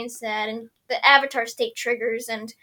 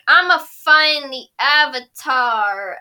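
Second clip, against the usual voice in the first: slow villain voice; 8 dB louder, much slower than usual. Retold or imitated.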